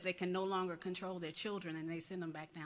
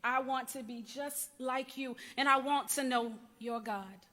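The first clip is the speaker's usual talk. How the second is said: higher, louder, impassioned testimony to an aunt; raised dramatic voice with weighted pauses; dramatic spoken-word monologue; 7 semitones higher, 8 dB louder than usual. Acted. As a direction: perform it as a heartfelt confession, building in force. Performs own monologue addressed to Aunt Millie.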